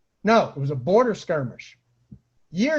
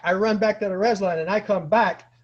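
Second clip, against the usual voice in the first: ordinary delivery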